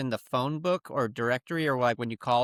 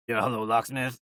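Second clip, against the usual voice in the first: a lower disguised phone voice; lower, laid-back disguised operator; fake voice; 4 semitones lower than usual. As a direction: put on a different guy to fool the caller. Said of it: "the amount of voices I had to come up with".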